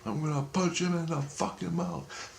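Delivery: high, soft imitation of a yelling man; mock yelling. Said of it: "this guy's yelling".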